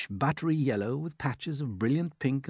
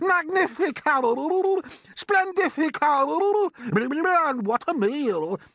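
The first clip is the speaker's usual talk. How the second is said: a much higher, louder put-on aunt's voice, excited; Aunt Sponge; 7 dB louder, much slower than usual. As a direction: play the greedy aunt gaping at the peach. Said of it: "Aunt Sponge cried out".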